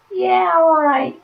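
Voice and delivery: high, loud, drawn-out whoop of approval; excited cheer. Real, not acted. Card reader applauding a call to celebrate, excited cheer.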